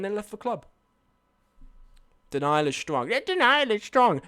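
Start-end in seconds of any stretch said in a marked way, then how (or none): 3.1-4.2 high, silly mock voice; mocking imitation. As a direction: parrot the teasing chat in a raised voice. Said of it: "That's how you sound".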